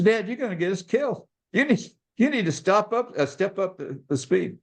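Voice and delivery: high-pitched retold plea to speed up; alarmed passenger. Son warning his dad on the interstate, alarmed.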